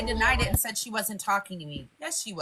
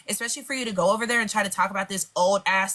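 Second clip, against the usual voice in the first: ordinary delivery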